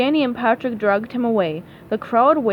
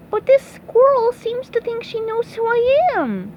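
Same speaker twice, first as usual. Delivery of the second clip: high cartoon voice; confused SpongeBob; 11 semitones higher, 4 dB louder than usual. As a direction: protest innocently in a high pitch.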